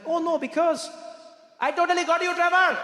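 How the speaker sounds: put-on high, gleeful Indian-accented waiter; loud, high Indian accent. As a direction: crow the prank in an Indian accent. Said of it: "you, you Indian".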